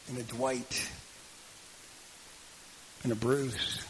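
unhurried prayer with long pauses. Real, not acted. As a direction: address God earnestly, pausing between phrases. Closes the sermon in prayer.

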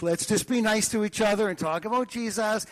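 a hint of a sweet fairy godmother; sweet toast. [name] raises a sweet toast.

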